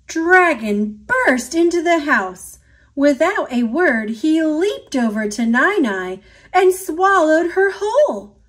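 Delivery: usual speaking voice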